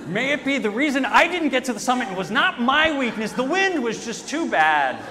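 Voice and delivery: loud, laughing fake justification; mock excuse. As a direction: pretend it was only the wind.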